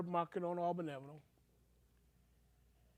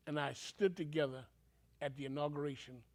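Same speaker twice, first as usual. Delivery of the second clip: ordinary talk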